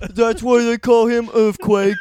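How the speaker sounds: very high, loud astonished gush; over-the-top awe; amazed fan. Pretend. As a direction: deaf fan raving about the comic. Deaf fan voice continues.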